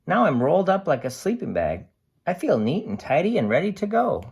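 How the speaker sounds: lively storybook voice for a can's diary; cheerful picture book narration; reading to children. A narrator's reading. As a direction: read it bright and animated to a class. Reads picture book aloud.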